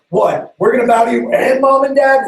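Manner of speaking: high, loud incredulous cry; outraged disbelief. Acted. Brothers hearing the dream, high and loud in outrage. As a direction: shriek it in disbelief. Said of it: "They're like".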